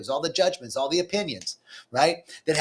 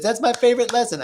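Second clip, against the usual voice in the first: quoting Sherry; 9 semitones higher, 5 dB louder than usual. Retold or imitated. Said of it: "Sherry's like, says".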